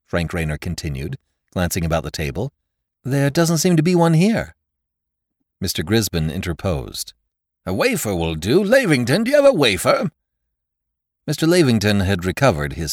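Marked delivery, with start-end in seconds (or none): 3.0-4.5 put-on lively young man voice. Nephew looks about table. 7.7-10.1 put-on older man voice. Lawyer interposes.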